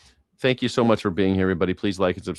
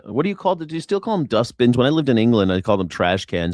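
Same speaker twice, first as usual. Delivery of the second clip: normal talking voice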